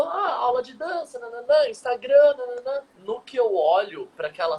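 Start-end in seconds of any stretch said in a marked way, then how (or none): 0.0-2.8 higher, blah-blah voice brushing it off; mocking chatter. Telling friends how the therapist moved on, mocking blah-blah. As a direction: rattle it off like small talk that doesn't matter. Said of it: "ah, falou".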